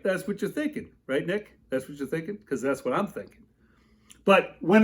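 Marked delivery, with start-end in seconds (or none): none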